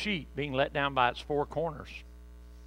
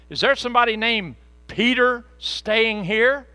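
higher and louder, calling through the door; messengers calling; 8 semitones higher, 9 dB louder than usual. Acted. Roman messengers calling at Peter's door, loud. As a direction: knock and call out. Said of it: "they said".

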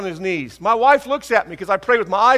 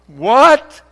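a loud, higher, shocked What; stunned what; 6 semitones higher, 11 dB louder than usual. Retold or imitated. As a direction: blurt it out in disbelief. Stunned by mother's remark.

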